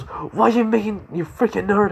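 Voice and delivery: high-pitched indignant mock yell; acted, strained high-pitched fury; angry outburst. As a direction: burst out like an exploding rival. Acted outburst from explosive rival.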